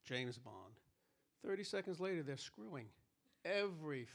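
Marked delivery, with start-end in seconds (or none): 0.0-0.8 low, soft suave spy voice; James Bond line. Retold or imitated. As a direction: purr it like a smooth secret agent. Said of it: "He'd walk up to a girl and go".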